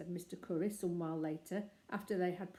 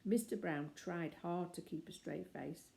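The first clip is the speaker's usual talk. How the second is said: ordinary delivery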